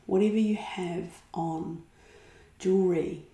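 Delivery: unhurried meditation voice with long pauses; calm relaxation-practice guidance, phrase by phrase; guided meditation. Acted. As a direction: guide them gently inward, leaving space after each phrase. Guides a body-awareness meditation.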